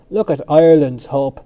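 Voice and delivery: loud, mocking announcement; scornful. A narrator's reading. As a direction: hold him up to public scorn. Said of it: "he said with scorn to the others".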